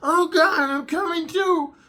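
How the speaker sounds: hammed-up falsetto cry of ecstasy; mock woman's voice. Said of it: "she's like".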